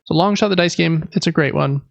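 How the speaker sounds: normal talking voice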